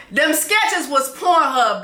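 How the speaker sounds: high, slow laughing through the words; cracking up as you say it. Real, not acted. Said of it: "I am screaming".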